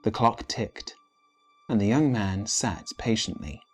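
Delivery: plain reading voice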